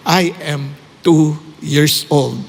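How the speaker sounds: put-on slow, faltering old man's voice, near tears; lightly voiced old man choking up, very slow; faltering tearful old man. An old man tells the preacher his age, slow faltering tearful. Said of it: "faltered in his voice, and the tears trickling".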